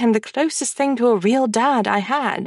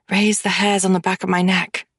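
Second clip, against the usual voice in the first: usual speaking voice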